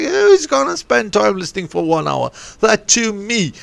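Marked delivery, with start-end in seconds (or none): 0.0-2.3 scoffing. Retold or imitated. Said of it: "I'm like".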